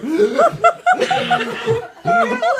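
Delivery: high squealing burst of laughter; shrieking laugh. Real, not acted. Telling about Quinny gasping, squealing laugh. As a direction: let a high laugh break out.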